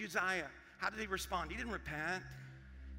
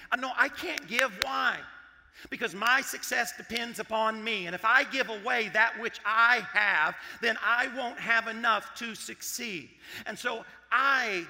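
loud, insistent, raised pitch; mock self-justifying protest; 3 semitones higher, 9 dB louder than usual. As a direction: protest loudly as the self-reliant believer. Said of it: "That's what we are saying".

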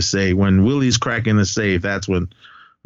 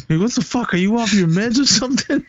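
much higher, startled imitation of Willie yelling; mock shocked yell; 11 semitones higher than usual. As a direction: imitate the shocked guy fending him off. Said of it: "He's like".

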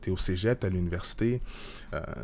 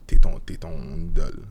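ordinary delivery

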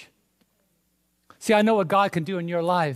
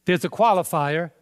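normal talking voice

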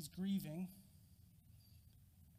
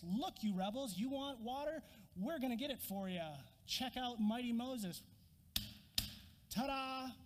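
theatrical showman Moses; 5 semitones higher than usual. Acted. Moses showing off to the rebels, theatrical showman. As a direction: play it like a stage magician showing off. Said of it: "it was much more like this".

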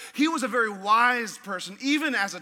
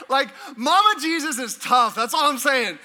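emphatic punchline; 3 semitones higher, 7 dB louder than usual. Real, not acted.

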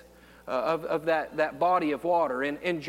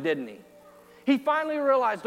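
ordinary talk, nothing marked

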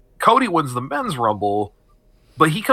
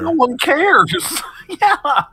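laughing; much slower than usual. Real, not acted. Laughing agreement, much higher.

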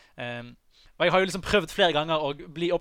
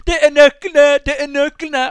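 high, very loud triumphant scream; 10 semitones higher, 12 dB louder, slower than usual. Retold or imitated. Singer announces the found keys to the crowd, screaming. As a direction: yell it out to a whole concert hall.

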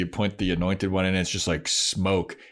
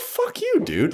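mock Spike snap; slower than usual. Spike's defiance quoted.

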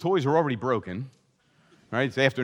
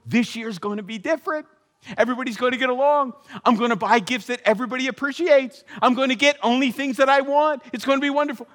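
mock excitement; 9 semitones higher, 4 dB louder, faster than usual. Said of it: "and you, you know".

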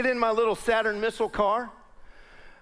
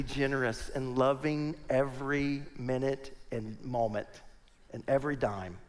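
tender and soft; 7 semitones lower, 8 dB quieter than usual. Real, not acted. Preacher telling church about giving, soft and sincere. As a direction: let it sink in gently, softer.

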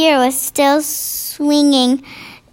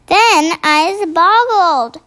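slow, lightly higher giant voice; gentle giant voice; 4 semitones higher, slower than usual. A narrator's reading. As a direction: let the giant marvel slowly. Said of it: "the B F G said".

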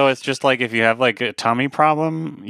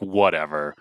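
resigned sigh; 7 semitones lower, 4 dB quieter than usual. Retold or imitated. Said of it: "they just sighed deeply, like".

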